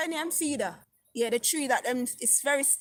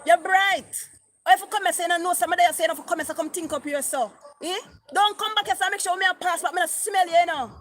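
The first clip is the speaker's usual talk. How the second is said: loud, higher mock cussing at a ghost; scolding a ghost she cannot see, loud mock cussing; cussing the duppy; 6 semitones higher, 5 dB louder, faster than usual. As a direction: tell off the spirit, half-joking. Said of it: "me start cuss the duppy".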